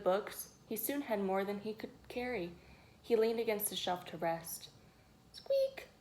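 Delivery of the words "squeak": squeaky high-pitched squeak. A narrator's reading. Shelf squeaking as George leans on it. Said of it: "went the shelf".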